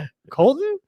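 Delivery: a startled double take at the name; high surprised. Retold or imitated. Host retelling his shock at seeing the grown-up boy, surprised and high. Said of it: "I was like".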